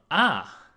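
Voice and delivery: a sharp agreeing ah; 第四声的啊; falling tone ah. Shown as a demonstration. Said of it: "can sort of be an interjection of agreement".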